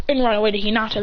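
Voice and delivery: plain reading voice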